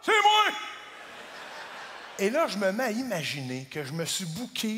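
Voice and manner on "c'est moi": a sudden high, loud cry of "C'est moi!"; shrieking c'est moi. Retold or imitated. Girlfriend announces it was her, in a high shriek. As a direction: pop up like a proud kid caught.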